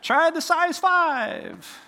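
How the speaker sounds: very high, drawn-out call; mock advice-giver. Onlooker advising failed fishermen, mock calling out. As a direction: sing out the tip in a silly high voice. Mimics unsolicited fishing advice.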